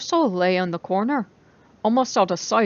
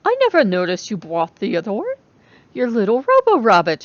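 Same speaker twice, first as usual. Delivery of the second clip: pleased; 2 semitones higher, 6 dB louder than usual. Acted. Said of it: "pleased to have remembered the name".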